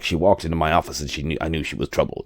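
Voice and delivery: a hint of a hard-boiled private eye; hard-boiled detective. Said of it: "that hard-boiled detective thing".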